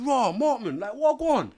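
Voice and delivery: put-on delighted to see an old friend; high, cheery mock greeting; happy surprise.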